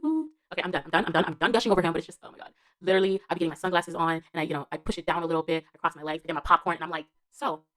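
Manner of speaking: usual speaking voice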